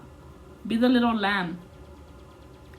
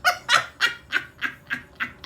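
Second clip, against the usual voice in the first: high mocking laugh; 13 semitones higher than usual. Jerk laughs at his joke.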